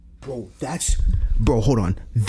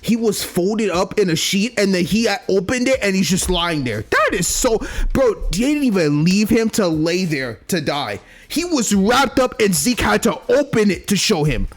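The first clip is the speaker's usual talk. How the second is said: excited; 7 semitones higher than usual. Real, not acted. Excited retelling of murder.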